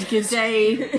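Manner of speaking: put-on Australian accent. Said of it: "Australian for beer".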